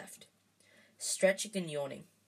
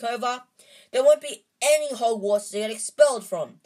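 raised angry voice, louder throughout; defiant outburst to his friends; shouting; 4 semitones higher, 9 dB louder than usual. A narrator's reading. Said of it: "Harry shouted".

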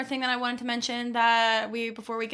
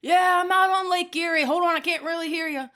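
imitating dad on the lake; 7 semitones higher than usual. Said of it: "he's like".

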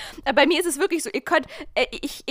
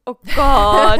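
laughing through a yawn; 10 dB louder than usual. Real, not acted. Yawning and laughing.

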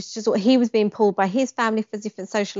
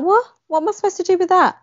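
high, fast, baffled outburst; as if the message makes no sense at all; incredulous disbelief; 11 semitones higher, 4 dB louder, faster than usual. Retold or imitated. Woman mocks her ex's out-of-the-blue holiday text, incredulous and high. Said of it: "I was like".

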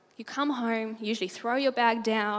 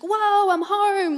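high, loud, drawn-out joyful cry; imitating an excited homecoming; delighted arrival; 9 semitones higher, 7 dB louder, much slower than usual. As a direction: burst in thrilled, stretching the words. Acts out arriving home delighted.

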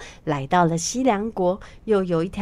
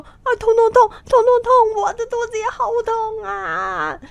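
much higher, much louder howl of pain; loud cry of pain; 13 semitones higher, 7 dB louder than usual. A narrator's reading. Pigsy clutching his big belly, howling in pain. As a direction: yelp each 痛 like a stomach cramp. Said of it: "大声地说".

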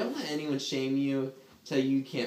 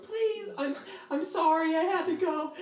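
put-on much higher, louder pleading kid; begging child; 3 dB louder than usual. Boy begs yelling dad, much higher pleading whine.

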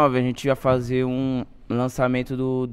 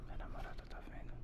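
quick secretive whisper, much softer; whispered aside; 21 dB quieter, faster than usual. Real, not acted. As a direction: whisper it fast so nobody hears. Girlfriend watching, aside.

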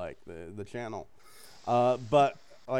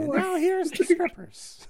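high, slow cartoon announcer voice; 4 dB louder than usual. Punchline parody of Rocky and Bullwinkle, high slow cartoon voice. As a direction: stretch it like a squeaky cartoon announcer. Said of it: "Rocky and Bullwinkle".

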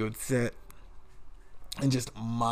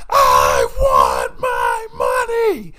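very high, very loud, stretched-out shriek; imitated screaming boss; 14 dB louder, much slower than usual. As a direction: scream each word out, mimicking a boss's tantrum. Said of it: "he would just yell and scream".

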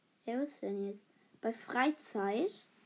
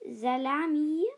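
ordinary delivery

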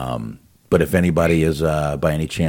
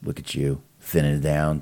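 usual speaking voice

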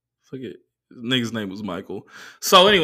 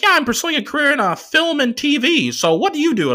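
much higher, louder chirpy voice; imitating the driver; 6 dB louder than usual. Comic mimicking his chatty driver in a high chirpy voice. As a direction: play the chatty driver eagerly asking. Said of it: "he was like".

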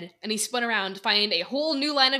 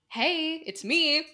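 slow, higher teasing voice; smirking; 8 semitones higher, much slower than usual. Acted. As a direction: tease him with a smirk. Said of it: "with an annoying smirk".

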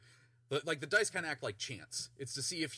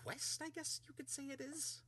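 Volo quest; 14 semitones higher, 7 dB quieter than usual. Acted. Volo hesitant, voiced.

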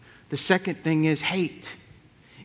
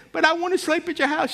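put-on old aunt reminiscing; 11 semitones higher than usual. Aunt telling her nephew about his dad, high-voiced. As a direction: gush the memory like a doting great-aunt. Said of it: "And she said".

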